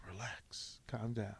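low, soft, deflating reply; hushed calming. Retold or imitated. Host retelling how he settled screaming Rico, hushed calming. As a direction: talk someone down under your breath.

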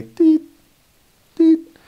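rapid high, loud electronic beeps; ping away like a detector going off; mock gadget beeping; beeping meter noise. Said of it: "I am the Lord-o-meter".

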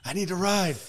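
loud, high outburst of frustration; exasperated acted-out shout; yelling. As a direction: yell it like you can't take it anymore. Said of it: "You were just yelling at her around".

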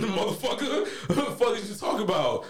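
normal talking voice

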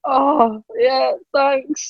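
embarrassed high-pitched mumble of thanks; flustered thanks. Retold or imitated. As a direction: squeak it out, caught off guard by a hug. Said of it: "Get a bit flustered".

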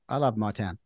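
usual speaking voice

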